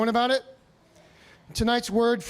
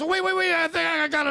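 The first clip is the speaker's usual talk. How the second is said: excited discovery; 6 semitones higher, faster than usual. Retold or imitated. Doctor's sudden discovery.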